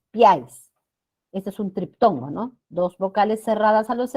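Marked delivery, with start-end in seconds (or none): 0.1-0.7 "piáis" as one loud syllable, PIÁIS; joined triphthong. Shown as a demonstration. Teacher giving students the right form, "piáis" joined as one syllable. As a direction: glide "piáis" together in a single stressed beat. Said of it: "es piáis".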